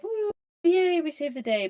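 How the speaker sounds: high, half-ironic woo yay; mock cheer. Group cheers after the battle.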